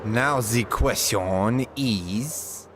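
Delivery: put-on slow, drawn-out German accent; villain-style German accent, slow and dark; fake German accent. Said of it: "my fake German accent".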